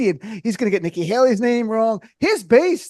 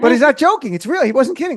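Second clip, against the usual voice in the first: ordinary talk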